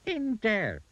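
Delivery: high-pitched little goblin. A narrator's reading. Goblin to rude Mister Uppity, high goblin voice. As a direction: speak well above your own pitch, as a goblin.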